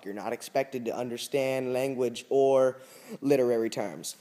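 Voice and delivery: ordinary delivery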